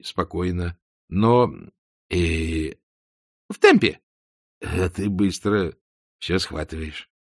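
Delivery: ordinary delivery, nothing marked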